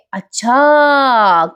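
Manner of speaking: long drawn-out, knowing "oh"; knowing realisation. Retold or imitated. Priya looks at Sayali and catches on, knowing drawl. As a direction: stretch it slowly as if it dawns.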